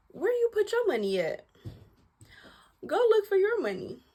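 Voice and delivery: high mocking sing-song; a high mock nag; mocking self-talk. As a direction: needle yourself in a high teasing voice. Mock-asking herself where money went.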